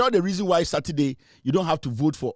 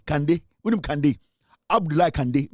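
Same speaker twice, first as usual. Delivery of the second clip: usual speaking voice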